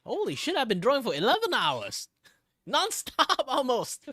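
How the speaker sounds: high loud burst of disbelief; shocked. Real, not acted. Streamer realizing they drew eleven hours straight, loud shocked disbelief. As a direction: blurt out real astonishment.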